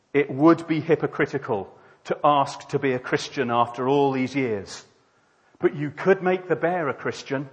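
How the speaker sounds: lightly voiced proud man bargaining; proud atheist. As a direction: argue back with a touch of pride. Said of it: "The atheist, ever proud".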